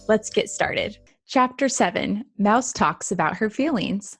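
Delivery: ordinary talk, nothing marked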